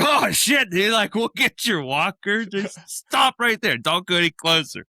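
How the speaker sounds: high, urgent retold warning; loud mock-alarmed reassurance; acted alarm. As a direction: call out as if halting someone, pitched high. Acted alarmed reaction to the man.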